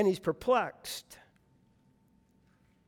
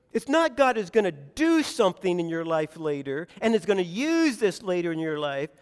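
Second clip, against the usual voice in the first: ordinary talk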